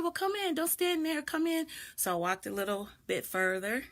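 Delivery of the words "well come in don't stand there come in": higher, insistent coaxing voice; mock him pressing her to come in; lightly voiced pushy neighbor, higher; imitating the man's urging. Man urging girl inside.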